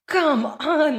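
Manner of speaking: high-pitched mock exasperation; exasperated. Guest voicing climate scientists facing deniers, exasperated. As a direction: plead like a fed-up expert.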